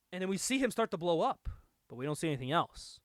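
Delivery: normal talking voice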